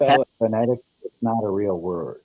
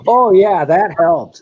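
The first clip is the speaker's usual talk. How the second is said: sarcastic; 9 semitones higher, 10 dB louder, slower than usual. Real, not acted. Sarcastic reply to clue.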